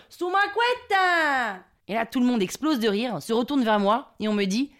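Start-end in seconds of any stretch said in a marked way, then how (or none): 0.1-1.6 high, very drawn-out yell; hollered bingo cry. Retold or imitated. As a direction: cry it out proudly across the hall. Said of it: "Et là, je hurle". (hurlement aigu et traînant)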